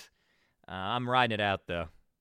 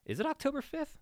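pitched way up in a mock double-take; mock disbelief; 14 semitones higher, 4 dB quieter, faster than usual. Shown as a demonstration. A podcaster doubting the date, asking way up high. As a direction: ask it high as if the date just hit you. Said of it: "repeats the date as question".

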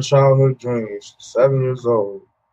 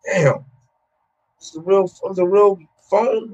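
surprised, pitched up, half-laughing realization; retold disbelief; 7 semitones higher than usual. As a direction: react like you just found out it's a real phone. Realizing the number is a cell.

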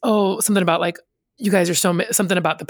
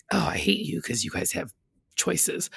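hushed, whispery envious grumble; grudging half-whisper, under the breath; mock envy; 8 dB quieter than usual. Older worker to younger people with choices, mock-envious whisper.

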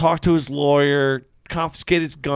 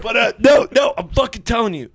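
wrestling promo; 6 semitones higher than usual. Acted. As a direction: yell it like a wrestler calling someone out. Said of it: "he's cutting a promo".

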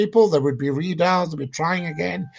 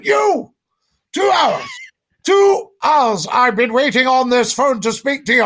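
shouting high and loud in outrage; enraged caller bellowing down the phone; angry yell; 5 semitones higher, 5 dB louder than usual. Retold or imitated. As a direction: yell it like a furious caller kept waiting. Caller yelling angrily at host.

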